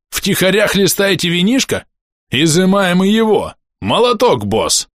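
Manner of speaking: ликующий рык, выше и громче; взревел от радости, как орк; радостный рёв. A narrator's reading. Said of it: "полуорк радостно взревел". (радостно ревёт)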